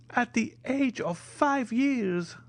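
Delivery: put-on high, slow, sighing old major. A narrator's reading. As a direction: grieve over the lost son. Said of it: "said the major with a deep sigh".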